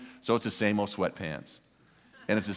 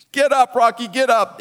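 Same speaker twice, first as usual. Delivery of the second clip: high, loud fan's plea; urgent urging; 12 semitones higher, 10 dB louder than usual. Retold or imitated. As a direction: yell him back onto his feet. Fan urging fallen boxer.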